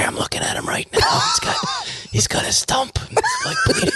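urgent hushed report from the scene; mock secretive whisper. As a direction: whisper as if hiding near the scene. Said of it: "No, the whisper is the".

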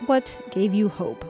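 normal talking voice

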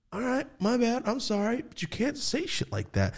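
high-pitched conciliatory voice; mock apologetic dad. Dad apologizing to his angry kid, high and placating. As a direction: imitate a dad backing down, then mildly scolding.

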